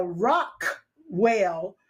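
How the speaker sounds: Rockwell broken in two after a popped K; pronounced K. Shown as a demonstration. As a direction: drag out Rockwell, releasing the K hard before "well". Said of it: "pronounce all of these names with the K's".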